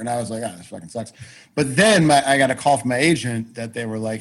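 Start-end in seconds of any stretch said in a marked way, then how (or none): 0.4-1.1 low, quiet retold grumble; dejected aside. Actor recalling failed auditions, dejected aside. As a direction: drop it low and soft, defeated. Retelling own dejection after rejections.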